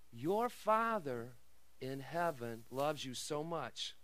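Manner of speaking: low, soft and slow, full of love; tender and hushed. Real, not acted. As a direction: reassure them softly of God's love. Tender close of sermon point.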